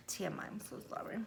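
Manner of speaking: fast, hushed sorry; quick whispered TMI apology. Real, not acted. Apologizing after throat clearing.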